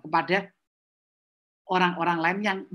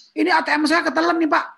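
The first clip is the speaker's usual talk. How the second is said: complaining customer; 7 semitones higher, 4 dB louder than usual. Acted.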